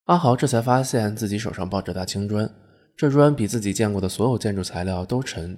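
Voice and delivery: plain reading voice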